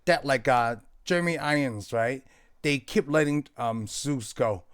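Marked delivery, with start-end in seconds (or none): none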